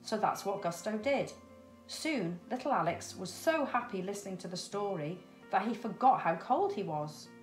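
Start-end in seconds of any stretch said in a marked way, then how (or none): none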